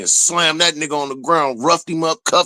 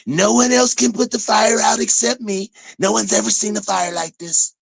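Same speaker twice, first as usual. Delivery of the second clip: higher-pitched, mocking quote of self-appointed saviors; mock fire-setters' boast; 7 semitones higher than usual. Fire-setters telling the rest of the country, mock boast. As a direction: lift the pitch to imitate their grand claim. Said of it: "the same people that set the fucking fire".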